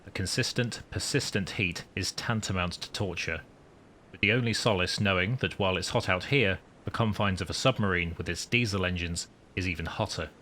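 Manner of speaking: deliberate, composed storytelling; captain's log narration. Acted. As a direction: narrate it like a submarine commander's log.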